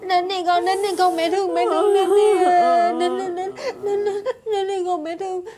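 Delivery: put-on high, wailing call to a child; mock-sob a coaxing, repeated plea; hammed-up tearful mother. Servant melodramatically plays mother calling child. (giả giọng mẹ khóc gọi con)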